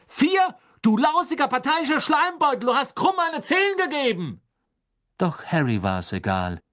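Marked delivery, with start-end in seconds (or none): 0.1-4.4 outraged bellow, high and loud; angry roar. Acted. Ron yells at Karkaroff over the unfair score, furious roar. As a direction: explode in furious disbelief. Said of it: "brüllte Ron zornig".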